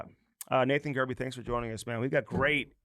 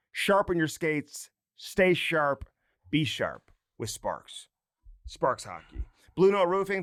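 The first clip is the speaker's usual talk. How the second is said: ad read; slower than usual. Acted. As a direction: spell out the sponsor like a radio ad. Host reads sponsor ads.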